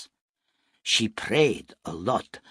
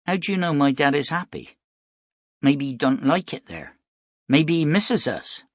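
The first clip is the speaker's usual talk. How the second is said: put-on higher-pitched, questioning little boy; young boy; 6 semitones higher, 3 dB louder than usual. A narrator's reading. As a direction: voice a boy unsure about his dad. Voices the worried young boy.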